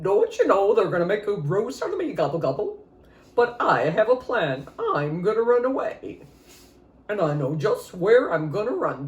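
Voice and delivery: a scheming turkey bragging about his escape. Acted. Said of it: "And he said".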